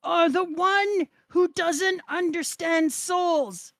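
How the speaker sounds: acted outraged accusation. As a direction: cry it out slowly at an opponent. Acted confrontation in comic reading.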